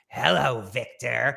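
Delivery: a hint of a creepy puppet. Co-host imitating the puppet's greeting, mildly creepy puppet voice. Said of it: "Was that [name]'s voice doing the".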